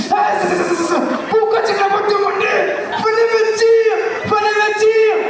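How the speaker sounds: put-on high shouted yelps of a burned old man; pained scream. Old client stung by chili alcohol, shouting high in pain. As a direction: scream it as the sting hits.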